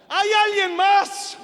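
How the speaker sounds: high, drawn-out yell with a rising end; acted climber's loud call; comic shout. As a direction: shout upward for a second opinion. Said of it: "y vuelve a gritar".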